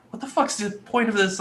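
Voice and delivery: usual speaking voice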